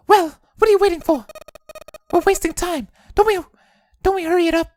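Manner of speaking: high, pushy woman's voice; impatient woman voice. Acted. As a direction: hurry them along, sharp and high. Voices Lotus.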